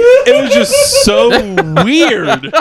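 high, cracking into laughter; laughing hard. Real, not acted. Recounting the tacos to friends, laughing hard. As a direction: squeal with laughter.

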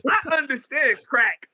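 high-pitched disbelieving retort; incredulous. Retold or imitated. Newcomer to New York crew who claim the blocks, incredulous. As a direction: squeal it in comic disbelief.

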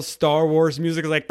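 normal talking voice

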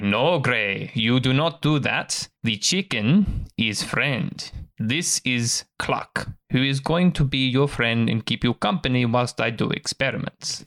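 slow, deliberate elf mentor; drawn-out, measured elf voice; elf master correcting. Acted. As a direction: spell it out patiently for a pup. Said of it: "the elf turns and says".

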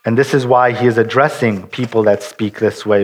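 normal talking voice

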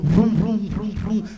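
mock car engine vroom; engine revving noise. Mimicking the car next to him revving its engine. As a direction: rev it like a show-off car.

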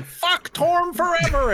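high, drawn-out battle cry; play-acted screamed curse; mock scream. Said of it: "I scream".

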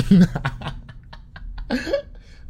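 high burst of laughter; cracking up loudly; helpless high laugh at own joke; big laugh. Real, not acted.

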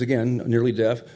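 ordinary talk

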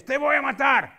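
loud Spanish scream. Retold or imitated. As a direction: shout the threat at him. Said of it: "Only in Spanish".

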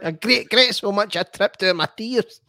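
high, mocking overwrought Scottish voice; mock dramatic quote. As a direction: parody the tearful comment in a high put-on voice. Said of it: "It's like".

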